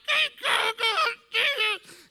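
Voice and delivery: loud, high strangled squawks; resisted laughter. Retold or imitated. Trying not to laugh at deathbed, strangled laughter. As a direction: force laughter out through a choke. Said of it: "so I'm being resistant".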